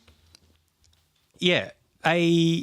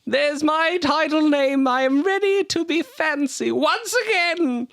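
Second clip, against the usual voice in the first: put-on pompous drunk lord. Lord Damo announcing his grand exit, high and pompous. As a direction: play the puffed-up drunk nobleman.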